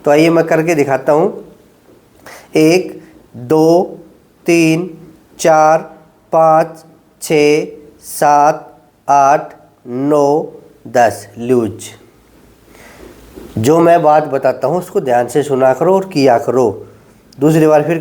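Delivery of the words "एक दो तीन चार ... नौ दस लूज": low, drawn-out counting through the hold; slow held count. Shown as a demonstration. Physio to patients, counting out a slow ten-second hold. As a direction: count each second slowly while holding the stretch.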